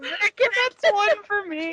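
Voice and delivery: high, slow squeaky put-on voice; squeaky mock voice. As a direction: squeak it out in a silly voice. Mocking the fanfic typo.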